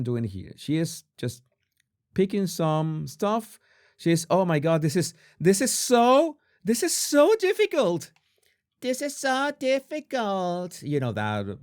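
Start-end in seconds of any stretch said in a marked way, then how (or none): 4.3-10.8 put-on Valley Girl accent. Host imitating Megan complaining about cooking, Valley Girl accent. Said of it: "Valley Girl accent from the South Park episode".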